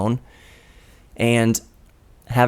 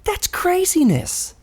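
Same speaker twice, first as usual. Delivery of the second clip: mock amazement; slower than usual.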